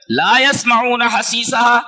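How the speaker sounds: slow, drawn-out chanted verse; slow melodic recitation; Quran recitation. Acted. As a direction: stretch each syllable like a reciter.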